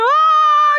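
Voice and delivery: loud, high dramatic gasp; excited gasp. Real, not acted. Reacting to dramatic parting.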